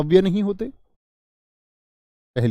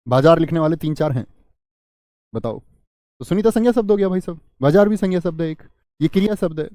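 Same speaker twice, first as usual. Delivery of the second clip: ordinary talk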